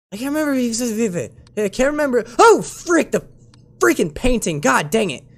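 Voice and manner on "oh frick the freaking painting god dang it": loud, high outburst of frustration; startled exasperation. Real, not acted. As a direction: curse the thing that just startled you. Startled by hated painting anomaly.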